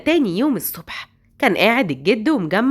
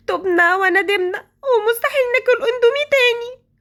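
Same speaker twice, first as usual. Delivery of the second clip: much higher, crying as she swears off it; crying plea; 10 semitones higher than usual. Acted. Girl to Hagar, crying and repentant. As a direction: plead through tears.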